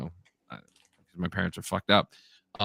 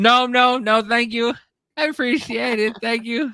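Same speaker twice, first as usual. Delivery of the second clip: high, loud, overly polite denial; hasty denial; 12 semitones higher, 5 dB louder than usual. Retold or imitated. Son answers his mother, high loud fake politeness. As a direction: cover up quickly with thanks. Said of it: "my mother goes".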